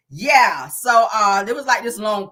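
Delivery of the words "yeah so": usual speaking voice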